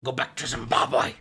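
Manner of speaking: a hint of the British lady; crazy British lady. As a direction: lightly imitate her telling someone off. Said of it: "British lady who's like".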